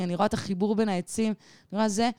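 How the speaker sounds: ordinary talk, nothing marked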